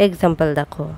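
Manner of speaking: normal talking voice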